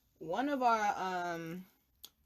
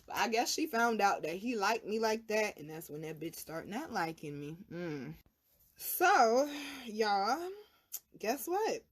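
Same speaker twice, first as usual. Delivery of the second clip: ordinary talk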